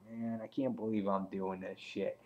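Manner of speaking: low, hushed grumble to himself; soft, resigned private aside; inner thought. Retold or imitated. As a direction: think it aloud under your breath. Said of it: "Rick's head the whole time".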